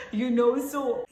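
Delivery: real laugh spilling into speech. Real, not acted. Storyteller amused by her own near-death scare, laughing. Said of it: "I'm laughing over it".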